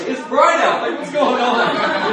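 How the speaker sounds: high, loud, laughing disbelief. Retold or imitated. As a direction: laugh it out in wet bewilderment. Drenched by sudden rain.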